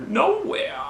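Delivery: "Nowhere!" leapt way up in pitch; excited exclaim. Shown as a demonstration. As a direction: yelp "Nowhere!" high and thrilled. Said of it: "not the place in Courage".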